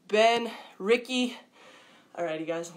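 normal talking voice

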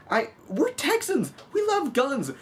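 high, hammed-up cheer; mock Texan pride. Vlogger joking to viewers about Texas gun love, hammed-up high cheer. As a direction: shout it like a proud cowboy fan, tongue in cheek.

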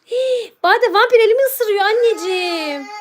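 theatrical vampire victim; mock fright. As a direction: gasp and call for rescue in pretend terror. Playacts victim calling Bade for rescue.

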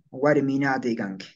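"Guarminate" with a long aa in na; slow "Guarminate", the a held double; double A variant. Shown as a demonstration. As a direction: draw out the na in "Guarminate canque". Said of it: "como doble A".

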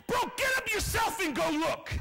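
high, loud indignant shouting; exasperated rant. Acted. Servant refusing to run to the hill, exasperated shouting. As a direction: yell back at the prophet, fed up. Fed-up servant tells prophet off.

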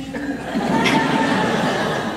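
high breathy laugh of wonder; a burst of joyful, disbelieving laughter; delighted laugh. Acted. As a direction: laugh in amazed delight at the flames. Unhurt in the flames, laughing.

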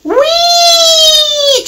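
long, very high squealed whee; cheering. A narrator's reading. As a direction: cheer like a delighted little engine. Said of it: "cheered Mimi".

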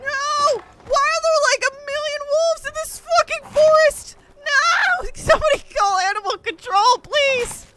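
high, loud shrieking despair; panicked wail. Real, not acted. Gamer overrun by wolves, shrieking in mock panic. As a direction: screech at the endless wolves. Wolves swarming, cry and shout.

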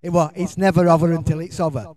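Yorkshire dialect, a touch proud. Shown as a demonstration. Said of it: "In my Yorkshire dialect".

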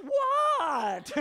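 put-on high child's "what?", annoyed; exasperated kid voice. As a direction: squeak it like an irritated little girl. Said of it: "she just puts it down and goes".

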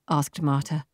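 ordinary delivery, nothing marked

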